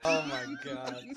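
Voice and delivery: slow, soft words through laughter; laughing. Real, not acted. Co-host reacting to the three-minutes line, laughing.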